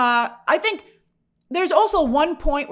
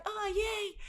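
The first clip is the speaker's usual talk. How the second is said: high, much softer, deadpan mock cheer; half-hearted sarcastic cheer; sarcastic yay; 10 semitones higher, 13 dB quieter than usual. Viewer mocking the brief action break, soft sarcastic yay.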